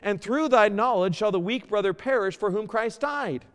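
loud, high preaching read; fervent scripture reading. Acted. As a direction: proclaim the verse to the congregation. Reads scripture at full preaching force.

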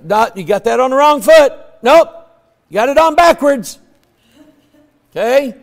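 lightly acted parent, quick and loud; high, hurried parental correction; parent correcting a toddler. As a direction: correct a small child briskly.

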